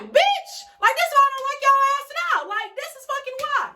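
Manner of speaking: yelling at the cops in disbelief; angry outburst. Retold or imitated. Snapping at the officers who arrested her, high furious yell.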